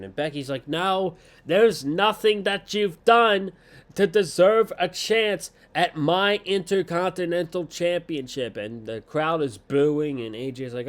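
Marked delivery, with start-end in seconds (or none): 0.7-8.6 high, loud wrestler's protest; hammed-up outraged denial; mock angry refusal. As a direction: imitate an indignant promo, easing off at the end. Imitating Becky's angry refusal to rival.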